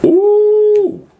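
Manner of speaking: high delighted ooh; excited squeal. Real, not acted. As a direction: squeal at the taste. Tasting fries.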